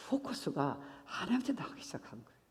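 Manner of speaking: unvoiced whisper; preacher whispering the key point. Real, not acted. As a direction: drop to a breathy aside.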